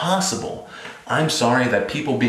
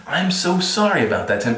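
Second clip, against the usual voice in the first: mock apology; 8 semitones higher than usual.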